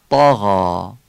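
طاغا loud, short dark "tɑ" then long "ghɑɑ"; heavy and full. Shown as a demonstration. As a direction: say طاغا with a full emphatic ط and stretched ending. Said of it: "درشت و پر تلفظ میکنید".

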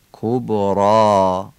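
کبرا drawn out very slowly, with a crisp k and a full r; heavy r demo. Shown as a demonstration. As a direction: slowly savor کبرا in recitation style.

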